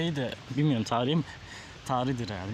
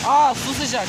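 surprised; 5 semitones higher, 8 dB louder, much slower than usual. Real, not acted. Surprised to find warm water.

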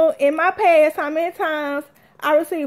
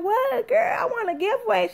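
high, excited exclamation; retold excitement; 7 semitones higher than usual. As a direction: re-enact thrilled disbelief at being shushed. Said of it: "I was so excited".

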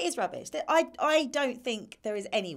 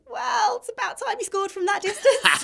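high, slow, louder mock jibe; teasing tweet voice; 12 semitones higher, 5 dB louder than usual. Eric Dyer ribbing Dele over his goal, high teasing voice. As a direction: pitch it way up as a playful dig.

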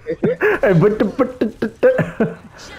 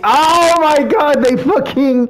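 outraged; 10 semitones higher, slower than usual. Real, not acted. Outraged slow wail.

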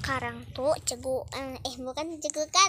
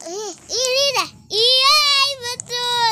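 excited; 9 semitones higher, 13 dB louder, slower than usual. Real, not acted. Solving the puzzle, triumphant.

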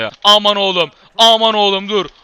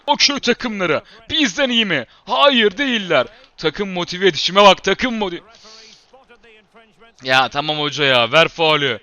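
normal talking voice

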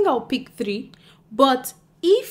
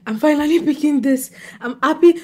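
louder, with a laugh breaking in; happy and excited; 4 semitones higher, 7 dB louder than usual. Real, not acted. Picking her next book, she bursts out happily and loudly. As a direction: burst out with joy at finally picking it.

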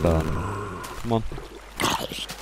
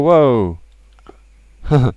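surprised; 5 semitones higher, 8 dB louder than usual. Real, not acted. Spots a strange block in game.

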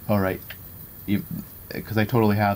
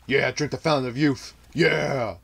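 higher-pitched, gloating cheer; overexcited; 5 semitones higher than usual. Real, not acted. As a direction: crow over the fight, carried away. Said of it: "I'm getting way too excited".

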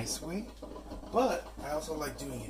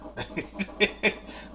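short laugh; 9 semitones higher than usual. Real, not acted. Laughs at burnt taste.

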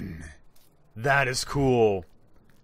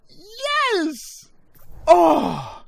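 thrilled gasps; 11 dB louder, much slower than usual. Real, not acted. Another rare item revealed.

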